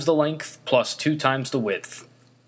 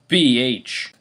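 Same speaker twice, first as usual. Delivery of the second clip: usual speaking voice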